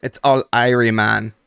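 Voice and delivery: loud Jamaican patois "It's all Irie, man"; Jamaican accent. Retold or imitated. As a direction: imitate a college friend's Jamaican accent. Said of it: "that accent".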